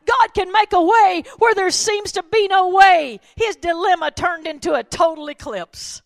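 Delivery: loud, high, building to a shout; triumphant preacher's cry; full-force preaching. Acted. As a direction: lift the story into a ringing sermon peak.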